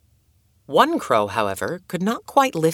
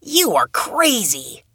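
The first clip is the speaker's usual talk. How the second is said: put-on slow, higher boy's voice, dismissive; scoffing boy; 8 semitones higher, slower than usual. A narrator's reading. Boy scoffing at his cousin's plan, dismissive. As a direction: tell her she's lost it. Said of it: "said Awan".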